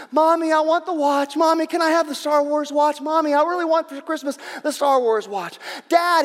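put-on high, loud, insistent kid nagging his parents; pleading little boy. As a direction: beg like an excited small child. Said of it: "like the little boy at Christmas time".

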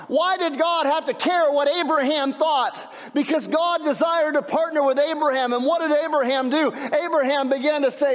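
high-pitched, driving preacher delivery; impassioned preaching. Real, not acted. Preacher telling why God wanted Abraham's opinion, impassioned preaching. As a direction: press the question on the congregation.

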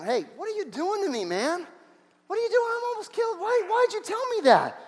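high, incredulous reproach; hurt disbelief at being set up; exasperated complaint. Acted. Abimelech scolds Abraham for the lie, high and exasperated.

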